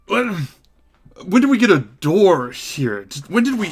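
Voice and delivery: high, swooping puzzled voice; befuddled old-world smith. Acted. Smith finds a portal in his shop, befuddled and high-pitched. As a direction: stammer in bewilderment, stretching the words.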